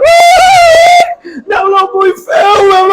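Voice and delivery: llanto gritado muy agudo y fuerte; llanto fingido. Retold or imitated. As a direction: imita a la hermana que llora a gritos. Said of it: "Empezó a llorar". (la hermana llora a gritos, muy aguda)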